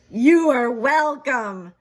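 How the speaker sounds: loud, slow, drawn-out welcome; grand Piggy. Acted. Piggy answers Gerald's thanks, loud and slow. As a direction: stretch it out proudly.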